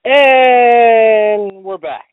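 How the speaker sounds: big slow radio host announce; radio announcer. Acted. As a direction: boom it out like a DJ. Host back from break, announcer.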